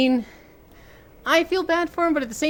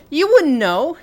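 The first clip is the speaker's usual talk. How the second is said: heated outburst; 12 semitones higher, 5 dB louder than usual. Real, not acted. Heated argument about stabbing.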